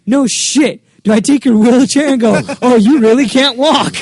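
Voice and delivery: mock-indignant comic rant; sarcastic comeback. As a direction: scoff it out, half laughing. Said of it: "it's like".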